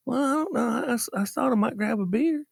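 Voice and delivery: a dumb thief making excuses; lightly voiced sheepish thief; lame excuse.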